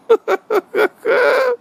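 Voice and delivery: shrill laugh at the punchline; high cackling laugh. Real, not acted.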